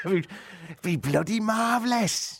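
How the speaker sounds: hammed-up West Country delight; West Country accent. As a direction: savour it like a West Country local. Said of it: "That's gone a bit West Country".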